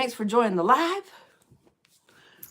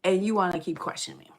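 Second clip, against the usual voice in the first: retold scolding; 8 semitones higher, 3 dB louder than usual. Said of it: "I'm like".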